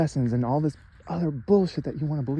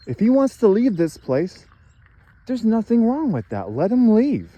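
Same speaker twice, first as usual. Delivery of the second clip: raised pitch, firmly granting permission; imagined voice from the sky; 9 semitones higher, 4 dB louder than usual. Retold or imitated. A voice from the sky grants his leaving, pitched high. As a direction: voice the sky's calm verdict, pitched up and a bit louder. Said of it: "nothing coming in the sky saying".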